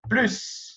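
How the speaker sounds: "Plus" with the S sounded, French style. Shown as a demonstration. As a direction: say "Plus" the French way, S clear at the end. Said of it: "essa palavra que é plus". ("plus" pronunciado à francesa, com o S final)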